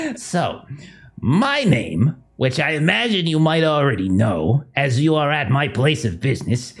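high, giggling menacing villain; creepy villain voice. Acted. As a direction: gloat like an unhinged captor.

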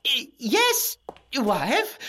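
high, stammering startled reply; nervous stammer. Acted. Husband answering his bossy wife, high and startled. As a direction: jump when she calls.

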